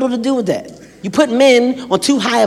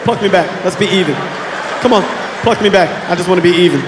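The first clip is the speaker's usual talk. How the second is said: scared pleading; 2 semitones lower than usual. Retold or imitated. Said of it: "Yeah, I was scared".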